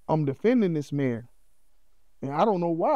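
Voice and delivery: usual speaking voice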